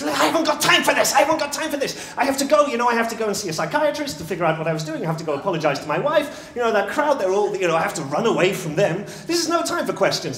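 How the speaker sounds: loud, high, frantic outburst; panicked protest. Acted. Troubled man fleeing the crowd protests to storyteller, panicked.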